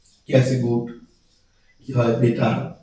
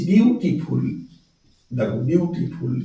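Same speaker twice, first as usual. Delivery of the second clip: slow, drawn-out "beautiful"; syllable by syllable; 3 dB quieter, slower than usual. Shown as a demonstration. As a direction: stretch "beautiful" into its separate syllables. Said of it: "ভেঙে ভেঙে উচ্চারণ করতে হয়".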